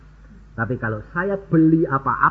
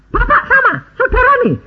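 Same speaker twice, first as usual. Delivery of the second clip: imitating the seller, high and a bit louder; astonished; 4 dB louder, faster than usual. Seller realising the buyer is Yusa, mock high astonishment. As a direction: jump up in pitch in disbelief.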